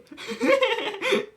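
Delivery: squealing giggle; high burst of laughter. Real, not acted.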